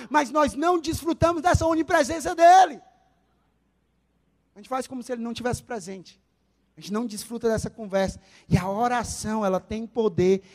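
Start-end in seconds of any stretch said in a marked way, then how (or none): none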